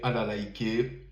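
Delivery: usual speaking voice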